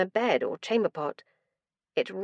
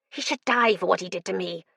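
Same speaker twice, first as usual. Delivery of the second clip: a hint of another creature's voice; alien inner voice. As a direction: let something else speak through you. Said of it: "Not my voice, not my accent".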